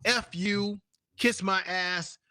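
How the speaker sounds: ordinary talk, nothing marked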